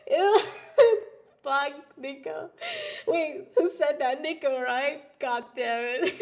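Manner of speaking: laughing through the words. Real, not acted. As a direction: giggle while reading chat. Laughing at chat message.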